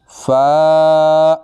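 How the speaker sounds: فا stretched one alif, high; elongated fa. Shown as a demonstration. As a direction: draw out the standing fatha. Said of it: "ایک الف کی مقدار لمبا".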